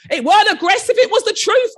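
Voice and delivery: high, loud laughing retort; shouted. Retold or imitated. Head calling back to the staff while leaving, with a laughing shout. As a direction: shout it back over your shoulder, laughing. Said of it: "I shouted".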